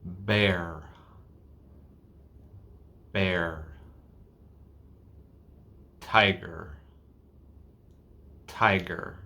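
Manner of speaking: slow, isolated words with a held R ending "Bear", "Tiger", "Car", "Your", "Sweater"; exaggerated final R. Shown as a demonstration. Teacher drilling final R for learners, long R at the end of "Bear", "Tiger", "Car". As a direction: draw out the hard American R closing "Car" and "Sweater". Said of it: "let's look at the R in the final positions".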